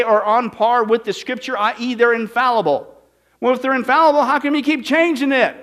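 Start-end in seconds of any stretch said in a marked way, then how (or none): 3.4-5.5 high, loud rhetorical demand; louder, higher accusing question; indignant challenge. Acted. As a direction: nail the hypocrisy.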